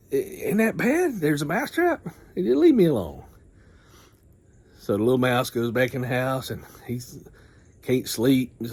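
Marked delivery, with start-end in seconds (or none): 0.1-3.2 pleading animal voice. Retold or imitated. Mouse and chicken arguing, higher voice. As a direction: squeak out a worried question.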